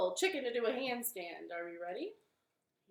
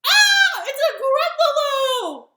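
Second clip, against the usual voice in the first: high, very loud acted shriek of fright; storybook bunny shrieking in alarm; terrified scream; 13 semitones higher, 14 dB louder than usual. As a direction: scream the words out in mock terror. Bunny terrified on seeing Gruffalo.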